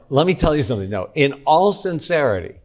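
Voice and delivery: ordinary delivery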